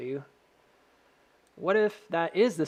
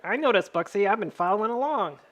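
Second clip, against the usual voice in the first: a hint of a smug viewer, a little higher; mock the viewers lightly; lightly voiced smug viewer answering the streamer; 5 semitones higher, 3 dB louder than usual. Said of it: "Why are you talking in my voice like that?".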